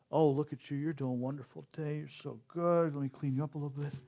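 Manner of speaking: soft, low coo to a plant; cooing to a houseplant. Retold or imitated. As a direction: murmur sweet praise to a little plant. Talking sweetly to a houseplant.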